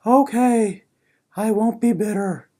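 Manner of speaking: defeated acceptance. Pretend. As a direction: give up, deflated and quiet.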